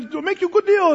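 high-pitched salesman's wheedle; imitated haggling merchant; pushy shopkeeper. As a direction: pitch the bargain high and eager. Acts pushy shopkeeper offering deal.

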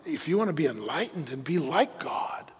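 soft, luring tempter's murmur; seductive near-whisper. Acted. Satan tempting Eve to be like God, soft and luring. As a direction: tempt gently and quietly. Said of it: "it's this whisper that sounds so good".

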